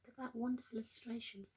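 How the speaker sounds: usual speaking voice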